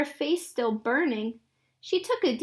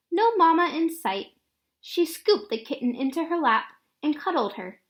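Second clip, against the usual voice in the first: ordinary delivery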